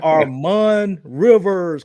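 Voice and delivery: slow, stretched winner reveal; booming prize announcer. Acted. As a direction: drag out the name like a host.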